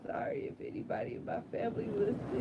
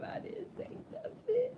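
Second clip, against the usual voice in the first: crying; 9 semitones higher, 5 dB quieter than usual. Real, not acted. Breaking down.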